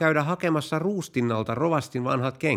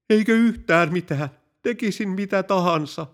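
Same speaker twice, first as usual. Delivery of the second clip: high, strained begging voice; desperate pleading; 8 semitones higher, 3 dB louder than usual. Acted. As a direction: beg for your life, much higher. Desperate general begs the pilot. (kenraali anelee lentäjää epätoivoisesti, korkealla äänellä)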